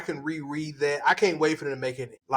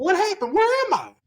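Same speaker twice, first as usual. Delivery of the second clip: confused high voice; 14 semitones higher, 4 dB louder than usual. Retold or imitated. Beheaded hunter confused, voiced high.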